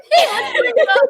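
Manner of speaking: teasing through a laugh. Real, not acted.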